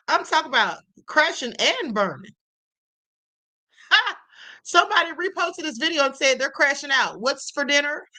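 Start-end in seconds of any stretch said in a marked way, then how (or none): none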